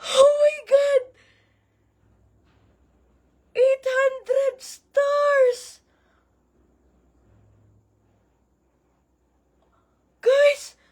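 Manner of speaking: high, drawn-out disbelief; stunned amazement. Real, not acted. Streamer seeing a huge gift arrive, stunned. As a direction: stretch it out, high and overwhelmed.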